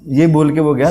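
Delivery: ordinary talk, nothing marked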